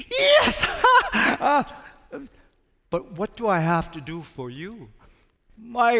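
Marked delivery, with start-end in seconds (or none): 0.1-1.6 very high, loud squeal of delight; hammed-up rat cheering, much higher and louder; rat's joyful squeal. Rat rejoices at the offer.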